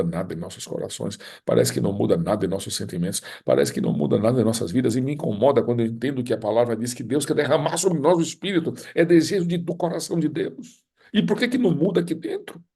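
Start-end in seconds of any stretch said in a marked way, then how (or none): 5.0-12.6 high, anguished preacher's appeal; impassioned preaching. Real, not acted. Preacher questions why hearts don't change, impassioned and high. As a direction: build to a high, urgent cry.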